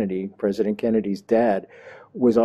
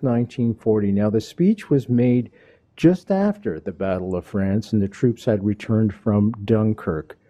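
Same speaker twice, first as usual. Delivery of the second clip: usual speaking voice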